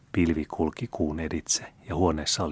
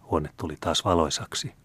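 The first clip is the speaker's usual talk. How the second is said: plain reading voice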